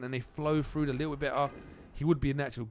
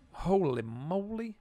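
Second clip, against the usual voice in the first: high, slow holy moly fading away; drawn-out awed exclamation trailing off; stretched amazement dying down; amazed; 5 semitones higher, slower than usual. Real, not acted. Amazed during fight.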